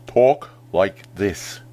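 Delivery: slow, mouth wide open, every sound shaped; over-enunciate like James Earl Jones; deep resonant voice with exaggerated mouth. Shown as a demonstration. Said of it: "don't just talk like this".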